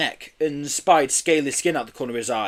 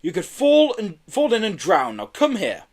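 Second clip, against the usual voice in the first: father warning; 7 semitones higher, 4 dB louder than usual. A narrator's reading. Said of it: "interrupted his father".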